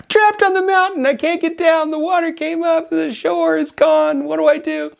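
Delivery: high-pitched mock distress call. Stranded hiker explaining his trouble to boatman, mock calling out. Shouting down to the boat.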